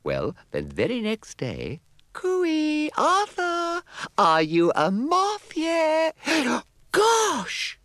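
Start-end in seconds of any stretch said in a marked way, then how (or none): 2.1-6.1 very high, bright calling fairy voice. Acted. Fairy Mavis calls out. 6.3-7.8 very high astonished exclamation; surprised fairy gasp. Acted.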